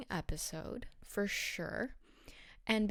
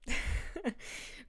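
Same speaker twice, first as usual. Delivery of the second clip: short breathy laugh at her own joke; amused laugh; 5 semitones higher than usual. Real, not acted. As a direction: laugh at the thought. Mocking the couple decoration.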